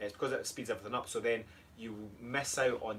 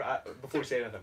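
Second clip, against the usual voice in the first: ordinary talk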